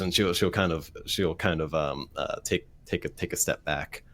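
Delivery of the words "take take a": usual speaking voice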